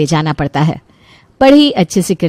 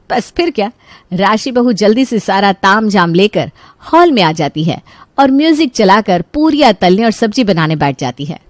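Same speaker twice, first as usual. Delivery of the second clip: ordinary delivery, nothing marked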